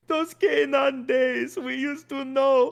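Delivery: high, slow, hammed-up wailing; melodramatic crying. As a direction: overdo the tearful lament. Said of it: "at one point he goes".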